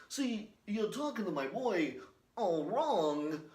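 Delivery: a hammed-up line from a movie, slow; movie quote impression. Vlogger quoting a film in a put-on voice.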